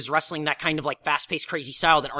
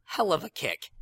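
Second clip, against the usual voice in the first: usual speaking voice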